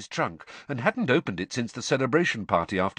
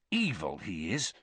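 bitter; 3 semitones lower, 6 dB quieter than usual. A narrator's reading. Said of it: "Ron said bitterly".

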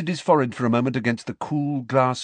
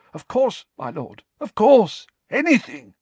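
eager servant; 12 semitones higher, 7 dB louder than usual. Acted.

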